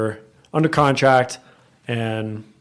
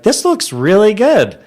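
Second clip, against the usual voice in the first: much higher, slow and drawn out; retold delight; 9 semitones higher, 5 dB louder than usual. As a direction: stretch it out like happy surprise. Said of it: "They're like".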